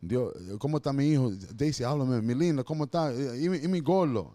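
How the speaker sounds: hammed-up father, hurried pleading prayer; father pleading in Spanish. Father begging the silent mother for news of his son, mock pleading. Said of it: "Say it in Spanish".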